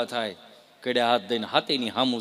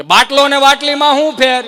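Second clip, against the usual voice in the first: much higher and louder, stretching બાટલીમાં; louder repeat; 11 semitones higher, 14 dB louder than usual. Acted. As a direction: belt out the question again so the back row hears, leaning on બાટલીમાં. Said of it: "કે ફરીથી બોલો તો". (બાટલીમાં પર ભાર મૂકીને ઘણું ઊંચું અને જોરથી ફરી પૂછવું)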